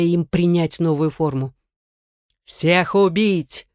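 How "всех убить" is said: slow, drawn-out booming command. Acted. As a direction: thunder it out slowly. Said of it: "громыхнул голос ректора".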